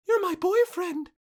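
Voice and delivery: put-on high, loud panicked girl voice; mock panic. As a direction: imitate a girl blurting in panic. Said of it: "looks at me in a panic and says".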